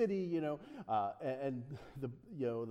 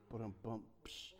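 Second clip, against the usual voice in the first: rimshot; 8 semitones lower, 14 dB quieter than usual. Pretend. Rimshot after a joke.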